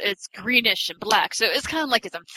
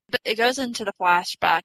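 normal talking voice

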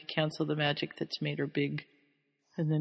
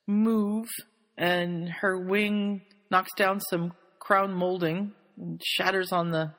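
ordinary delivery

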